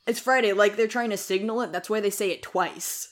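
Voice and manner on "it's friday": mildly urgent, a bit louder and higher; pressing announcement. Acted. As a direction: flag it up quickly as pressing news. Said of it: "Like, urgent".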